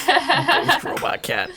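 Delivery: giggling through the words, pitched high; laughing. Real, not acted. Laughing as she names the ghost robot cat. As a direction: laugh it out slowly.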